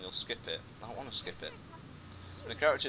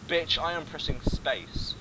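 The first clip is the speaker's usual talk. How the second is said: louder, slow mock snap; exasperated with the game, half joking; mock annoyance; 5 semitones higher, 6 dB louder, slower than usual. Gamer scolds the tutorial, slow loud mock exasperation.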